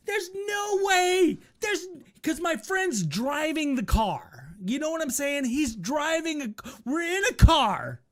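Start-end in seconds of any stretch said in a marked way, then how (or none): 0.1-1.9 disbelief. Retold or imitated. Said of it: "And it was like".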